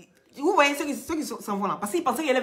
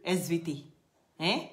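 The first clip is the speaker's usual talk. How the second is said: usual speaking voice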